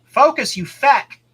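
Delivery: high, loud playful shout; jokey loud insult; mock yell. Mock yelling at camera.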